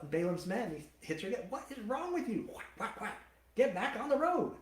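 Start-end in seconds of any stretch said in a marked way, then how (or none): 1.5-4.5 high mock angry Balaam. Balaam yelling at his donkey.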